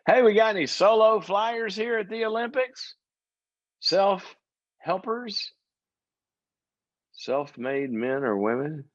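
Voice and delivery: mock interviewer question. Preacher pretends to quiz Olympic athletes, high mock interviewer voice. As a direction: pitch way up and poke fun at the athletes as you ask. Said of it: "Just, just ask 'em".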